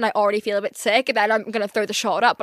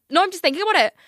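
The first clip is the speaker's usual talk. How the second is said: ordinary talk, nothing marked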